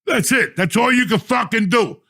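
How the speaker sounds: high, loud exasperated outburst; angry dismissal. Retold or imitated. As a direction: finish the telling-off at full indignation. Telling off the airline kid.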